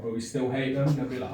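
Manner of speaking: normal talking voice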